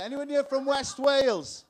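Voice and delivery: loud, slow call to the crowd. Real, not acted. Comic calling out to the audience, loud and slow. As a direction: shout it out to the whole room.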